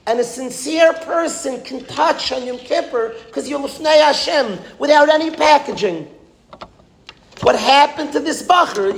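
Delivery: high-pitched, emphatic sermon delivery; impassioned preaching. Real, not acted. Rabbi telling listeners Yom Kippur needs no packaging, impassioned preaching. Preaches the moral with raised intensity.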